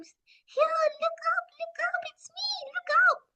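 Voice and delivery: high whispered bird call; chirpy little bird whispering down; tiny bird voice. Acted. Little bird calls from tree.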